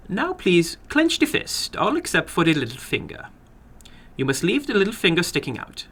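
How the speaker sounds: a little man running his game with relish. Acted. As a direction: play the little man, keen and formal. Little man readies chopper.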